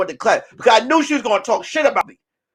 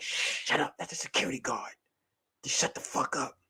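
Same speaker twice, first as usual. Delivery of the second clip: whisper; 8 semitones lower, 17 dB quieter than usual. Retold or imitated. Hiding from security, whispering.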